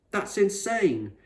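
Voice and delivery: put-on a kid appalled at the explorer's choice; indignant boy. Angry boy reacts to explorer.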